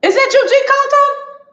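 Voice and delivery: high, loud and slow delighted greeting; excited call-out. Real, not acted. Spotting a viewer in the chat, a high, slow excited shout. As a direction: sing out a viewer's name in surprise.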